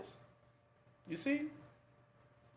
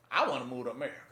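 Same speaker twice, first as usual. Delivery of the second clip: lower, dreamy I wanna move to America; a wistful newcomer, lower; eager would-be emigrant; 7 semitones lower, much faster than usual. Retold or imitated. Preacher voicing people who long for America, lower and dreamy.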